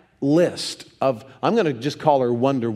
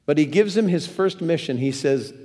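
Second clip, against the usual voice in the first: normal talking voice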